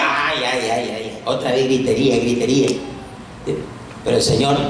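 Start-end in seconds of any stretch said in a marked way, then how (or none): 0.0-2.7 low, grumbled complaint; annoyed disciples. Acted. Disciples bothered by the woman's shouting, annoyed grumble. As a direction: grumble low about the noise. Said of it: "A sus discípulos les ponía".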